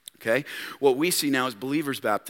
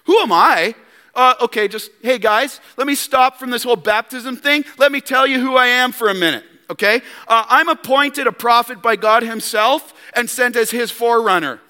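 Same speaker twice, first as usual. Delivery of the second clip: loud, higher, self-important boasting; defensive bragging John; 7 semitones higher, 5 dB louder than usual. Retold or imitated. John defending himself to the leaders, boastful. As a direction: puff yourself up and show off credentials. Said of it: "We're gonna go into defensive mode".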